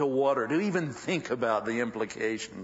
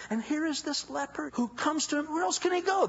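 normal talking voice

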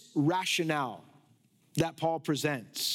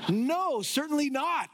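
high-pitched, amused rejection; emphatic denial; 9 semitones higher, slower than usual. Acted. Preacher rejecting an absurd argument to his congregation, emphatic laughing denial. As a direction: throw it out emphatically through a laugh.

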